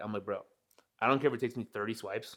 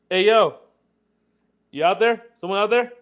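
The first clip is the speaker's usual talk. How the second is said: loud call, higher, toward someone outside the stall; 7 semitones higher, 8 dB louder than usual. Retold or imitated. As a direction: shout out from inside the toilet stall. Calling from stall without paper.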